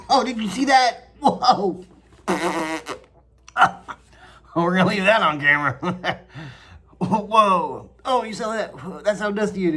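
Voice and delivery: high loud startled whoa; real surprise, laughing; surprised laughing. Real, not acted. Surprised at something on camera.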